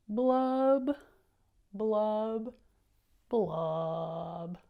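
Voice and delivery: loud popping blubs, falling at the end; fish blub sounds. Shown as a demonstration. Reader shows the class the fish's loud blubbing. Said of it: "look what he does here".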